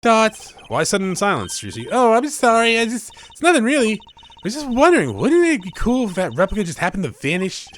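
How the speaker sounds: put-on high, bright young magician girl; voicing the girl sidekick, perky and high; cheery girl voice. Voices Trucy in game dialogue.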